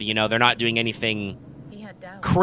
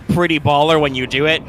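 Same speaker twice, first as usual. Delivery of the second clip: normal talking voice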